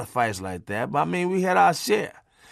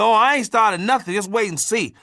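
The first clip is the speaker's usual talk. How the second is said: defiant wife; 6 semitones higher, 4 dB louder than usual. Retold or imitated.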